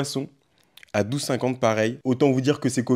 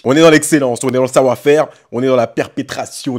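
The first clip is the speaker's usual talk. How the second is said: mock grandiose announcer; 10 dB louder than usual. Mock pompous brand slogan.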